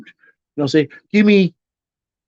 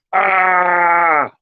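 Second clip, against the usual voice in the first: mock shocked cry; 5 semitones higher than usual. Mock shriek at meat eater.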